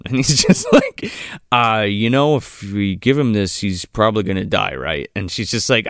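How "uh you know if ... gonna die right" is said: low-pitched casual imitation; lightly voiced nonchalant doctor; unbothered doctor. Host imitating the doctor weighing up giving medicine, unbothered.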